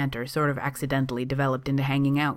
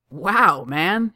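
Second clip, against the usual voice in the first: impressed wow, higher and louder; lively, amused amazement; grinning; 4 semitones higher, 7 dB louder than usual. A narrator's reading. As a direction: say it through a grin. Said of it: "flashing a grin".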